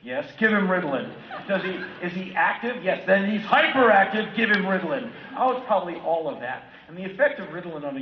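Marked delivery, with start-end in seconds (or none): 0.0-5.1 loud mock-brisk doctor prescribing; hammed-up quick doctor handing out pills; mock doctor dialogue. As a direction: bark the prescriptions, much louder. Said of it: "they're just giving Ritalin to kids like".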